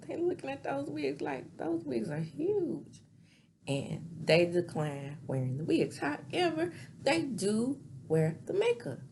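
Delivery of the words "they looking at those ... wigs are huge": Trixie aside. Retold or imitated. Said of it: "And she's like".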